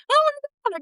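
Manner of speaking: high, bursting exclamation; reliving the joy of being selected; mock excitement. After getting into the choir, a high mock squeal. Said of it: "running like a crazy woman".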